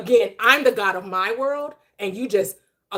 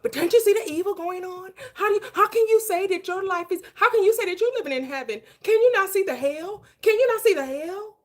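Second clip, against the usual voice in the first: high, mocking preachy voice; mocking quote; 8 semitones higher than usual. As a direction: imitate them nagging about the hell. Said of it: "'Cause they're like".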